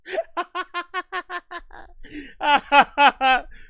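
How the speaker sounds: long, high burst of real laughter. Real, not acted. Cracking up at a viewer's chat comment.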